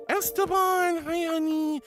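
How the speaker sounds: gasp, then a high, loud, drawn-out Esteban; delighted to spot a friend in chat; excited greeting. Real, not acted. Greeting a returning viewer.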